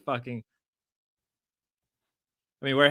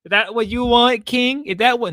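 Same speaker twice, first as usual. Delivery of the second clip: loud, higher teasing demand; mock taunting; 8 semitones higher, 8 dB louder than usual. Streamer pressing King about feet, mock taunt. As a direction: press the question hard and loud.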